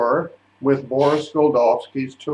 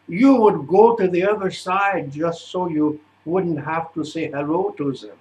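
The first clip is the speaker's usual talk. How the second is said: put-on old-fashioned German English, lilting higher; thick German accent; 3 semitones higher than usual. Director tells a singer he hates the opera world, thick German accent. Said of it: "in a very thick, old-fashioned German English".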